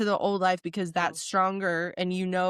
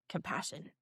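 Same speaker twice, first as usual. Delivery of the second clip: much softer, almost breathed; soft repeated word; 13 dB quieter than usual. Real, not acted. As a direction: let the word fade out like a mantra.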